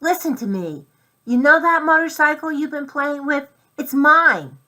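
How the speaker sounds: high, loud mouse shout; as loud as he can. A narrator's reading. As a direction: yell with all his tiny might. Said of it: "as loud as he could".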